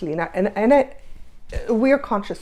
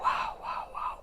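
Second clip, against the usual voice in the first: whispered wow; 13 dB quieter than usual. Retold or imitated. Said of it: "And I was like".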